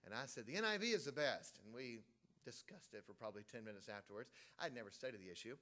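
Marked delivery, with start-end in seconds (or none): 0.4-1.6 retold boast, punched louder; emphatic assertion. Preacher retelling his old argument for the NIV, emphatic. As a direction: insist on it as if arguing the point.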